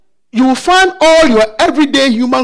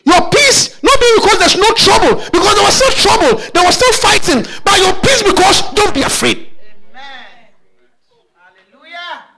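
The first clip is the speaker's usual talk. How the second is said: fiery preaching; 7 semitones higher than usual. Acted. Preacher to anxious congregation, fiery shouted preaching. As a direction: preach it hard, voice rising and cracking with urgency.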